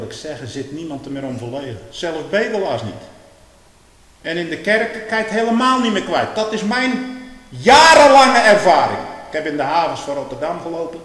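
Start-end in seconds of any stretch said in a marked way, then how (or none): 4.2-11.0 loud, rising pulpit preaching; preaching at full force. Acted. Preacher raising his voice against the world. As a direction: thunder it from the pulpit.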